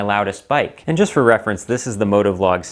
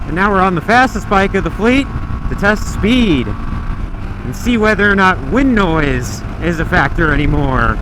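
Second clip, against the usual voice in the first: inside a helmet; 6 semitones higher, 5 dB louder than usual. Real, not acted. Riding fastest bike at speed.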